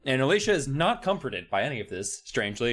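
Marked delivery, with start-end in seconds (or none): none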